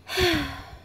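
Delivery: high, breathy falling sigh; caboose's sigh. A narrator's reading. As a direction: let out a long tired sigh. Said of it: "sighed the little red caboose".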